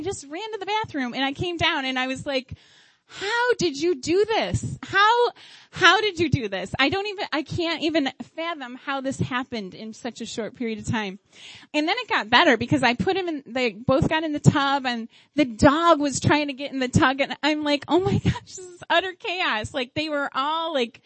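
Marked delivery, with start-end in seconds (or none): 3.1-6.7 high, drawn-out incredulous question; mock disbelief. Mother facing a yogurt-covered kitchen, acted disbelief. 17.9-19.7 high-pitched, mock overwhelmed outcry; acted overwhelm. Mother watching kids and dog in the tub, acted overwhelm.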